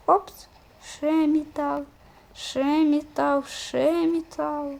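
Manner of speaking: slow sing-song. Acted. Gamer chanting finds slowly.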